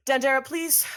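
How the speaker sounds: loud, high, airy summons; calling out. Acted. Villain summoning Dandara to send in the others, calling out. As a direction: call a servant with brisk courtesy.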